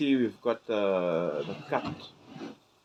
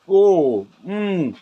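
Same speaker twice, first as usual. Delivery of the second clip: delighted; 7 semitones higher, 7 dB louder than usual. Real, not acted. First bite of steak.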